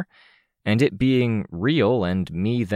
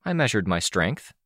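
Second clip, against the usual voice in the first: ordinary delivery, nothing marked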